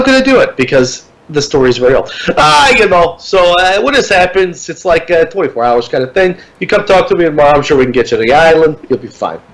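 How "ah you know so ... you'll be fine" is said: high, relaxed put-on Puerto Rican accent. Clerk reassuring the stranded couple, put-on Puerto Rican accent. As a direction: give him a breezy Puerto Rican accent. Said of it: "I'm gonna give him this accent".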